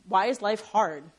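ordinary talk

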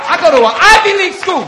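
high, very loud student retort; indignant mock shout. Students snapping back at the pastor, loud indignation. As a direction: shout it as an outraged comeback.

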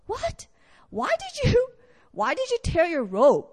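sudden high pitched What, rising in disbelief; incredulous prophet. Acted. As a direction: cry out in shock at the king. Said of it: "and Elijah goes".

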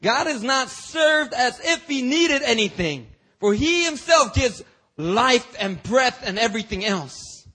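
preaching fervor. Acted. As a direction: proclaim it to the congregation. Preaching climax.

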